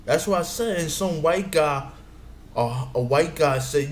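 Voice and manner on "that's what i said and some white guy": ordinary delivery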